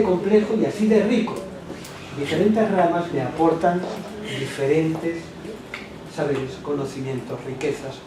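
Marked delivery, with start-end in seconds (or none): none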